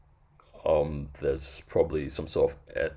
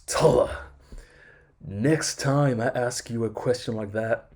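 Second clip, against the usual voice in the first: raised, lecturing a junior with mock disdain; mock disdainful boss; 7 semitones higher than usual. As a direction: imitate a condescending senior manager. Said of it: "disdain in his voice".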